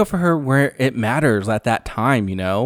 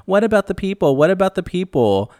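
mock the victims with a nasal sneer; mocking imitation; 7 semitones higher than usual. [name] mocking the victims, sneering and higher. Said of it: "mocking these victims".

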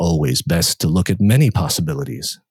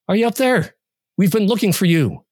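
higher worried voice calling through the door; 11 semitones higher than usual. Acted. Searcher at the door calling the narrator, higher and worried. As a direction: call out gently, searching.

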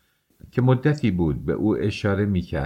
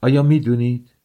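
flashing challenge; 4 semitones higher, 7 dB louder than usual. A narrator's reading.

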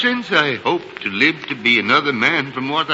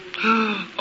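shocked gasp; 8 semitones higher, 5 dB quieter than usual. Acted. Scrooge sees his own tombstone.

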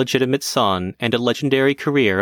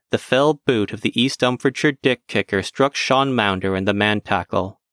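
plain reading voice

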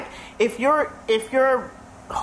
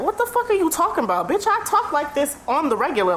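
higher-pitched, exasperated comeback; indignant retort; 6 semitones higher than usual. Retold or imitated. Worker answering coworkers who mock her proper speech, indignant. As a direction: act out firing back, affronted and incredulous.

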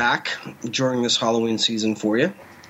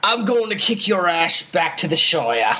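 higher, put-on character voice; mock threat; 5 semitones higher than usual. Mock-threatening his co-host about Hobbits, put-on higher voice. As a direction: threaten in a hammed-up voice.

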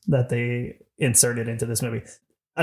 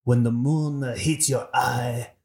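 slow, exaggerated mock Italian; drawn-out Italian crooner; Italian accent; slower than usual. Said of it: "who has the best Italian accent".

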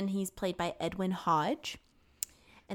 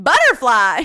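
mock blurt; 3 semitones higher, 16 dB louder, slower than usual. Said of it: "And then it's like".

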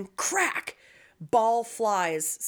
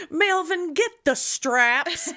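high, loud movie-line bark through a laugh; Pulp Fiction quote; 9 semitones higher, 5 dB louder, slower than usual. Retold or imitated. Quoting Pulp Fiction to a friend, high and loud through laughter. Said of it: "it's from Pulp Fiction".

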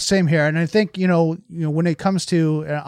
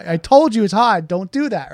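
scolding parent; 5 semitones higher, 5 dB louder than usual. Retold or imitated. Parent scolds burnt child.

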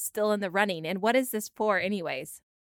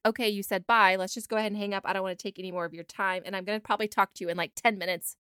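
quick, softer inner monologue; imitating her own thoughts; 3 semitones lower, 4 dB quieter than usual. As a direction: rattle off the reasons to hang up. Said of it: "I just feel like".

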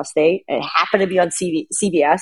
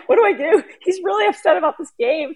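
worried sister-in-law; 11 semitones higher than usual. Retold or imitated. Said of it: "Kim's like".

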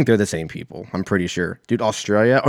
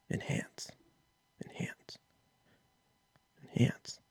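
mock whispered command; 16 dB quieter, slower than usual. Podcaster pretending to command his search screen, hushed whisper. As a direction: whisper it, voiceless and very soft.

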